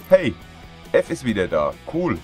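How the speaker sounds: ordinary talk, nothing marked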